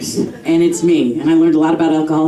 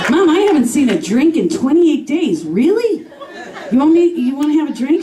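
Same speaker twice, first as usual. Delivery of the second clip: incredulous; 7 semitones higher than usual. Retold or imitated. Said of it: "son answering mother".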